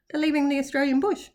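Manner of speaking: ordinary delivery, nothing marked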